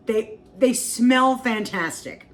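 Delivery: drawn out with delight; slow savoring. Real, not acted. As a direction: savor every word slowly. Savoring fresh baked meatballs.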